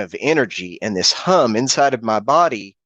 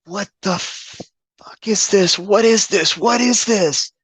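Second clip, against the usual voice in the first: Zach screaming; 9 semitones higher, slower than usual. Retold or imitated. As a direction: mimic a horrified friend, rising higher with each question. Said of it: "Zach is screaming and he's".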